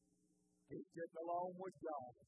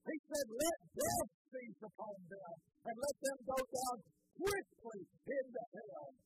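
louder, driving pulpit delivery; fiery preaching; 3 semitones higher, 5 dB louder than usual. Acted. Pastor stirring up the congregation about David's anger, loud preaching. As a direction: preach it with rising heat.

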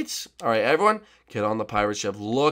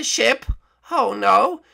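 whiny guard; 8 semitones higher, much slower than usual. Acted. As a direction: whine up high at being woken. Banana Guard voiced.

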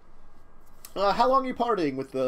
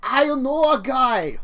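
put-on German accent; 8 semitones higher, 6 dB louder, slower than usual. NPC thug offering help.

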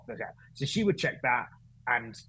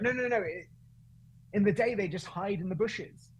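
high Welsh lilt for the driver; Welsh accent; 7 semitones higher than usual. Retold or imitated. Driver explains where hippos hide by day, high Welsh accent. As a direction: imitate the driver in pitched-up Welsh. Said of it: "I went into Welsh accent for the Kenyan".

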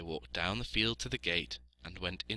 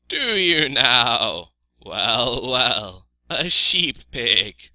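slow, loud bleating sheep; Ma the sheep, reacting; 4 semitones higher, 13 dB louder, much slower than usual. A narrator's reading. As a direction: draw the words out like a sheep. Voices Ma the sheep.

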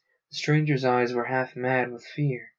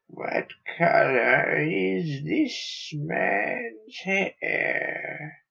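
very slow whispery alien question; 6 semitones higher, much slower than usual. Acted. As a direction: drag it out, cold and probing.

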